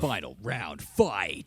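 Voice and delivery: deep, slow arcade announcer; booming fighting game announcer. Pretend. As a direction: boom it out like a fight game intro. Mock fighting game intro.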